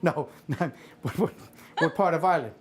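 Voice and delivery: ordinary talk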